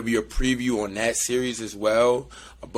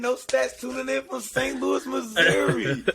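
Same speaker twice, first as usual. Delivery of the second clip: sing-song radio host call-out; hyped city shout-out; 14 semitones higher than usual. Pretend. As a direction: hype up the hometown like a host. Hype intro of hometown.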